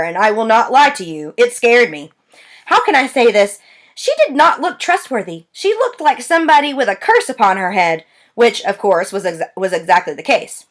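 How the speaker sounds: plain reading voice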